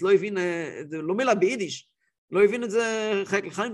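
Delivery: ordinary talk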